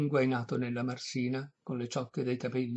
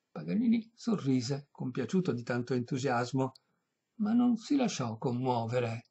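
usual speaking voice

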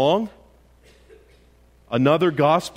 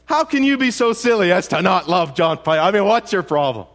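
louder, scornful disbelief; mock incredulous scorn; 7 semitones higher, 7 dB louder than usual. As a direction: sneer at their taste in preachers.

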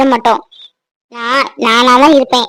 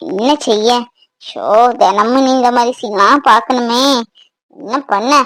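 low, fed-up inner monologue; lower, disdainful muttering; grumbling aside; 5 semitones lower than usual. Acted. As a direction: complain under your breath at the tearful scene. Wife grumbling aside at family scene.

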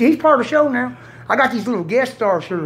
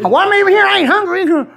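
higher, louder whiny complaint; mock complaining customer; 8 semitones higher, 3 dB louder than usual. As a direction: play a cranky customer in line. Acted quote, McDonald's customer.

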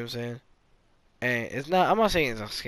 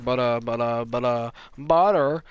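mock stuck on BUT, landing on BUTTER; imitating own stammer; 3 semitones lower, slower than usual. Vlogger mocking his habit of saying but, mock stammer. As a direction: repeat "but, uh" like a stuck record. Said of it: "every single time I'm like".

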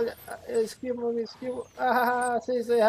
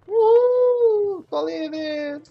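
high loud whoop of joy; excited whoop; 11 semitones higher, 9 dB louder, much slower than usual. Real, not acted. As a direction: cheer out loud. Jumping off, celebrating.

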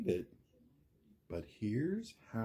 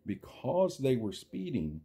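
lively, sing-song storybook read; reading to children. Acted. Reading a picture book aloud to young viewers, sing-song. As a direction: build each chain link like a bedtime story.

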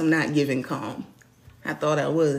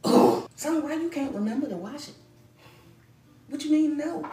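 exasperated mom; 10 semitones higher, 4 dB louder than usual. Real, not acted. Exasperated with son.

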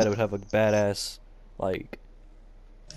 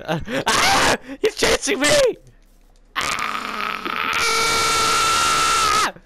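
panicked yelps while fleeing; high frantic cries, running away; being chased; 4 dB louder, much slower than usual. Real, not acted. Chased by a mob in game.

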